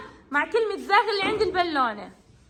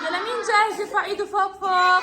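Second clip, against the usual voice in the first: excited call; 3 semitones higher than usual. Real, not acted. Teacher rallying class to volunteer.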